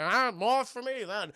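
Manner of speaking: lightly voiced tipsy host; a hint of a happy drunk; drunk old friend. Drunk friend keeping the port, lightly tipsy voice. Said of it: "who is a fucking drunk".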